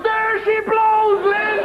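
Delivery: high, slow hammed-up lookout cry; mock sailor's shout. [name] calls from the crow's nest to the Queen, hammed-up high shout. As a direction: bellow it like a lookout up the mast.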